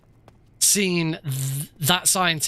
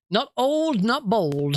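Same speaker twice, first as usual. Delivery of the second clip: mock Cornish accent; 5 semitones higher, slower than usual. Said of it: "A Cornish accent".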